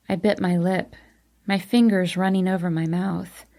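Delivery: plain reading voice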